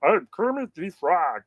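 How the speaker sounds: high, drawn-out puppet frog voice; poor but game frog-puppet impression; Kermit the Frog. Shown as a demonstration. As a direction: squawk it like a cartoon frog, pitched right up. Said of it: "in the sound of Kermit the Frog".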